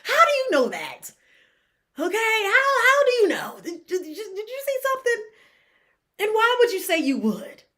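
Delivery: can't believe she just said that; incredulous disbelief. Real, not acted. Reacting to the mom's insult in baffled high disbelief. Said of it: "I was confused".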